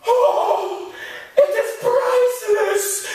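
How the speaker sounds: high, slow and hushed with reverence, almost sung; a shopkeeper breathing out the word priceless; awed shopkeeper. Retold or imitated. Mock-reverent shopkeeper to a sailor, drawn out. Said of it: "And he said".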